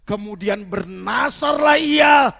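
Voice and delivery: high, drawn-out shouted declamation; slow, ringing preacher's shout; full-force scripture reading. Real, not acted. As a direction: proclaim the verse loudly to the whole church.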